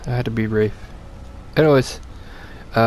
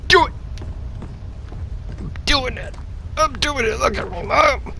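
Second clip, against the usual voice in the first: giddy; 11 semitones higher, 4 dB quieter than usual. Real, not acted. Running past monster, hyping self.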